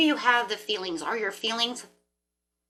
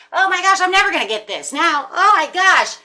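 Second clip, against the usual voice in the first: much higher and much louder, a hammed-up freak-out; mock panic; 9 semitones higher, 11 dB louder than usual. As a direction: cry out in pretend despair. Mock panic over manifesting.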